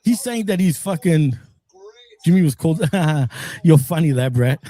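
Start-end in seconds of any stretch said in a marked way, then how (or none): 3.6-4.6 low, loud, through laughter; real amusement. Real, not acted. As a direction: crack up while saying it. Amused by the other's remark.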